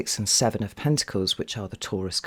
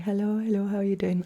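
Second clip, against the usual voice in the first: cooing hello; 10 semitones higher than usual. Real, not acted. Greeting newborn duckling through incubator.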